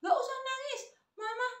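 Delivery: very high-pitched nagging granny; acted high, impatient grandma; scolding grandmother. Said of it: "si nenek menambahkan".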